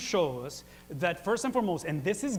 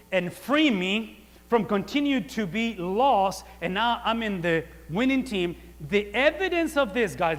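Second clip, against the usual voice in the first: loud, higher pulpit preaching that drives every phrase; preaching at full force; 3 semitones higher, 8 dB louder than usual. Acted. Pastor to church on wrong jersey, preaching at full force. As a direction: preach it hard and build the fire.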